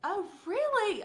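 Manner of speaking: slow mock surprise for the client. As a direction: act lightly impressed by the news. Acting her own surprised reply.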